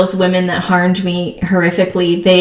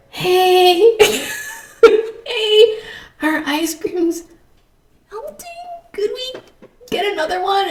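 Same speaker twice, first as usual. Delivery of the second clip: high, tearful-laughing mock plea, fading softer; sheepish pleading; 11 semitones higher, 5 dB quieter, slower than usual. Mom asks Disney counter for new cone, sheepish plea. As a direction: squeak out a timid, giggly request.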